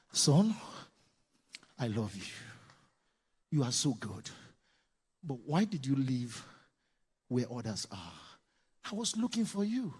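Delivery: soft, low, tender words to a son; gentle father. Shown as a demonstration. Father kneeling down, gently talking to his son. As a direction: speak softly to the child at eye level.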